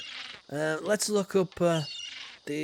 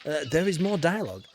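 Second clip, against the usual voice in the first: ordinary talk, nothing marked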